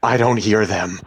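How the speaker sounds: startling yell, like a bomb going off; sudden shout. A narrator's reading. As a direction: shout it out of the silence. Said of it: "And then he shouted".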